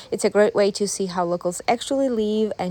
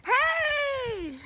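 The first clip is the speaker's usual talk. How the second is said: calling out. Real, not acted. Greeting Mila across trail.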